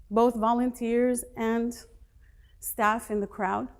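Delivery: ordinary delivery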